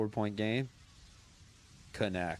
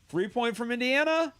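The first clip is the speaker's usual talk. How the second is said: loud, high excited commentary; excited call; 12 semitones higher, 6 dB louder than usual. Real, not acted. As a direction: shout the miss out live. Live call of missed three.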